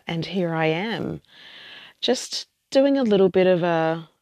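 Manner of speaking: ordinary talk, nothing marked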